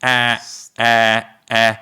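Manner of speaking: the vowel of cast, open; open vowel demo. Shown as a demonstration. Teacher showing students the open A of cast. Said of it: "mais assim".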